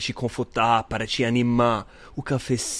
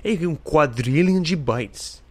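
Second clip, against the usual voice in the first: high, bright fact-reading voice; announcer voice; 5 semitones higher than usual. Acted. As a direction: read it like a trivia announcer. Reads facts in another voice.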